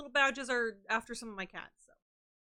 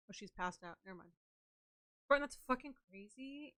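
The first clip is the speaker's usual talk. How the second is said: ordinary talk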